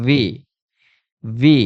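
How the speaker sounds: a buzzing v at the start of "ভি"; lip-teeth V. Shown as a demonstration. Teacher drilling the letter V, "ভি" with lip-teeth sound. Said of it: "উপরের দাঁত নিচের ঠোঁটে লাগবে".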